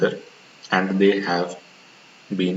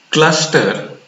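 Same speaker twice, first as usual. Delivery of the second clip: cluster said high and louder, falling; emphasized cluster; 6 semitones higher, 4 dB louder than usual. Real, not acted. Teacher to students, stressing cluster as the key word. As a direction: lean hard on cluster to drive it home. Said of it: "Why am I emphasizing on the word cluster".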